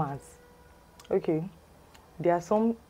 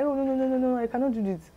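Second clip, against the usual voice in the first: high, rapid string of no's; acted emphatic refusal; 8 semitones higher, faster than usual. POS lady turning down the caller, imitated refusal. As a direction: refuse outright in a raised pitch.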